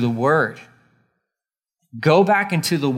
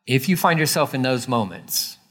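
normal talking voice

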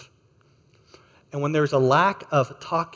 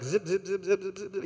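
light, soft, teasing zip-zip sounds; playful teasing; 3 semitones higher, 8 dB quieter than usual. Retold or imitated. Husband teasing wife while zipping her dress, playful. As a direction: flirt as you tug the zipper. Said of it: "trying to be flirtatious and funny".

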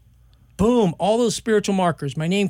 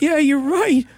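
high mock agreement; 11 semitones higher, 5 dB louder than usual. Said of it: "I was like".

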